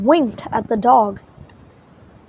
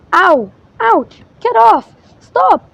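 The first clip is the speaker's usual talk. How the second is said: high, loud pained yelps; shouted complaints; 8 semitones higher, 6 dB louder, slower than usual. Acted. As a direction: cry out as balls pelt her. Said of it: "she shouted".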